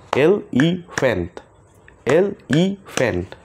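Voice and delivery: এলিফ্যান্ট slow in three beats, e-li-fent; slow clapped syllables. Shown as a demonstration. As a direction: clap three times, slowly: এলিফ্যান্ট. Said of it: "আমরা তালির মাধ্যমে সেই ওয়ার্ডগুলোকে শিখব".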